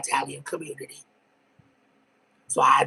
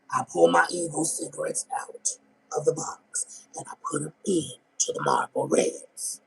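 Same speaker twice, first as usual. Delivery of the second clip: normal talking voice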